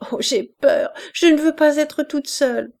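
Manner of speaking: high, loud fearful plea; frightened. A narrator's reading. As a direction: beg not to be left alone. Enfant délirante a peur. (plainte effrayée, aiguë et forte)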